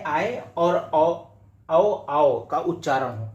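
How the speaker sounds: आए और औ stretched very slowly; vowels slowly drawn out. Shown as a demonstration. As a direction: draw out आए, औ, आओ clearly for the class. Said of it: "तो पहला ये है कि ऐ". (आए और औ को बहुत धीरे खींचकर बोलें)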